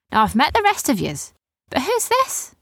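pirate lilt leaping high at the end; curious pirate girl. A narrator's reading. Erin asking about Rowan, curious pirate girl. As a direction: swoop up into an inquisitive question.

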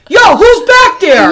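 calling out. Retold or imitated. Man challenging a noise in the woods, imitated calling out. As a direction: call into the distance. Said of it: "he calls down to the corner of the woods".

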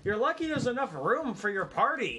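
exasperated. Real, not acted. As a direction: repeat it with annoyance. GM repeating, exasperated.

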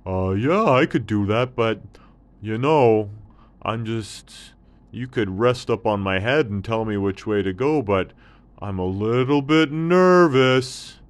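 a thirsty camel perking up; hint of a thirsty camel.

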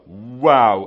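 loud, drawn-out mock amazement; retold big wow. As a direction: play up the scientists' amazement loudly. Retold amazement, repeated for effect.

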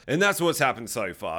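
ordinary delivery

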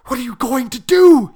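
ordinary delivery, nothing marked

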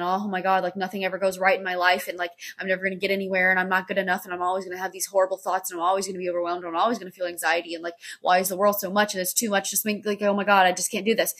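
rapid spiraling rant; overwhelmed, flustered. Acted. Acting out own anxious head spiral, flustered rush. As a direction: pile up worries without a breath, very fast. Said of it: "I'm feeling overwhelmed and I'm flustered".

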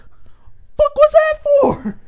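high, breathy strangled yelp of pain; startled yelp. Retold or imitated. Grandson hit by grandma's magazine, high startled yelp. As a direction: squeak it out like you just got whacked.

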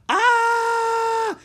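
high, loud frightened "Ah!"; a sudden scream at bared fangs; shriek of fright. Acted. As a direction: kids shrieking as the cobra opens its mouth. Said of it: "said Jack and Annie".

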